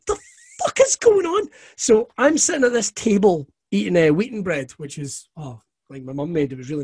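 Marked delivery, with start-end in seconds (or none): 0.1-1.5 sudden high incredulous yelp; high-pitched what-the-hell squeak; stunned disbelief. Retold or imitated. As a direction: pitch shooting up in shock at the absurdity. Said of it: "I'm like".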